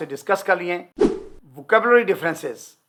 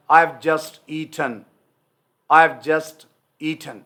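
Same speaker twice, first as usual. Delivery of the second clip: slow British sentence; much slower than usual. Shown as a demonstration. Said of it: "British इसको हमेशा ऐसे बोलेगा".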